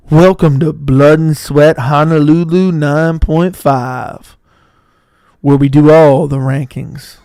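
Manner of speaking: slow, loud mock show host; hammed-up radio intro; announcer. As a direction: drag out the welcome like a radio host.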